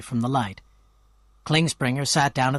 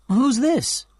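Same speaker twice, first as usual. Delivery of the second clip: curious question; 11 semitones higher, 4 dB louder than usual. A narrator's reading. Narrator asks about photo.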